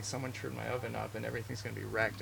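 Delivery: usual speaking voice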